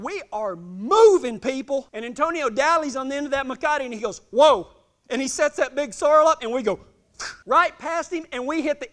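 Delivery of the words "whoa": sudden loud halt call; calling whoa. Retold or imitated. Cowboy halting a running colt, loud command. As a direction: call the horse to a stop.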